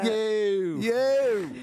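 loud, high Philly "Yo!"; Philly street greeting, called high and loud. Pretend. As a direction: bark out a Philly "Yo!". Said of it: "little Philly accent or something".

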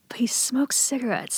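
hushed whispered aside; a soft conspiratorial whisper; retold whisper. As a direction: whisper it like a guilty secret. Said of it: "And I go".

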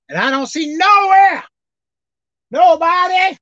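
high, loud preaching that cries out; fiery preaching. Real, not acted. Preacher to his congregation about life's journey, fiery crying preaching. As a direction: shout it to the church with a cry in the voice.

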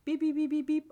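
high mock beeping of a phone; playful phone beep noises; imitated phone beeps. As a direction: chirp it like a ringing phone. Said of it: "I'm holding up my hand right now as though it's my phone".

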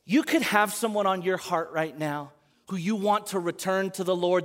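ordinary talk

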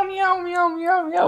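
very high meowing; cat meows. Pretend. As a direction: squeak out meows like a kitty. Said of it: "Then it just goes meow".

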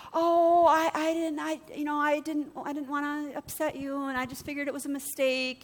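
a hint of a nervous babysitter apologizing to her employer; timid high voice. Voicing shy babysitter's reply.

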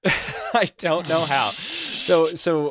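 amused disbelief breaking into laughter; laughing. Real, not acted. Laughs at Cole being absent.

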